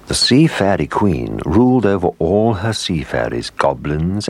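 plain reading voice

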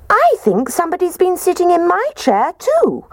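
high squeaky little bear voice; squeaky Baby Bear. Acted. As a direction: squeak it out like a tiny bear cub. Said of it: "And Baby Bear squeaked".

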